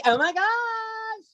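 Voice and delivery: put-on very high, slow drawn-out Valley Girl "Oh my gosh!"; Valley Girl accent. Shown as a demonstration. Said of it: "Ben's doing the impression for you".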